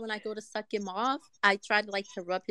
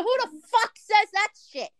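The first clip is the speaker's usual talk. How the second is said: high-pitched mock indignation; exasperated high-voiced quote; retold indignant outburst; 11 semitones higher than usual. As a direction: retell his disbelief, pitched way up. Retold argument with partner.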